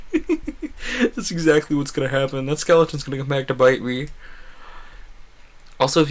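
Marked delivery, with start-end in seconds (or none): 0.0-4.1 laughing. Real, not acted. Laughing at own prediction.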